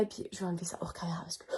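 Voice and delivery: fast whispered aside; whispering. Real, not acted. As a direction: whisper it quickly to yourself. Stressed aside about mascara smudge.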